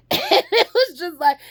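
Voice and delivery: laughing. Real, not acted. Laughs while recalling childhood.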